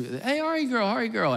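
high, singsong name-call; mimicked calling out. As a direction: imitate someone calling a little girl. Someone calls the child by nickname.

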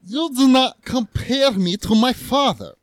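put-on high, slow European-accented rebuke. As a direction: warn them slowly in a European accent. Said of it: "All right, let's go European".

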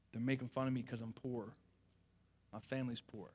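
put-on quiet, low retelling of a hesitant kid; hesitant confession. Hurt boy opens up to an adult, hesitant and low. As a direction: admit it softly and reluctantly, lightly imitated. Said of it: "hesitant to open up".

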